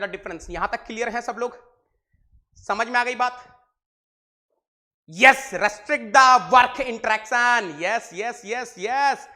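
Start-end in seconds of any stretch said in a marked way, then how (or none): 5.1-9.3 excited cheering. Real, not acted. Cheering on students' chat answers.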